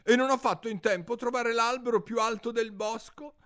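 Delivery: high, fussing bird voice; a harried mother bird listing her troubles; fretful pigeon. Acted. Pigeon complains to Alice, high and fretful.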